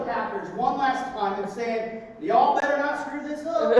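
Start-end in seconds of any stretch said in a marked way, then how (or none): 2.2-3.8 put-on Brett Favre warning the team in a country accent; Southern country accent, playful. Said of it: "He's got that country accent".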